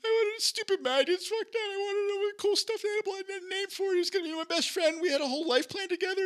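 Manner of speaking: put-on high, wailing little-kid voice; crying child voice. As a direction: wail it like a heartbroken four-year-old. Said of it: "I start crying 'cause I'm like".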